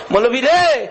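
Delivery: high, loud wail of address; tearful cry out. Retold or imitated. Weeping brother calling the cleric, high loud cry. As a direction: call out loudly, pitch arching up and falling. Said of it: "ভাই কান্দে আর কয়".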